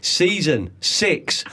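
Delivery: SEASON SIX slowed right down; said slowly. Shown as a demonstration. Host repeating the season number for the listeners, slow and deliberate. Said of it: "Let me say that slowly".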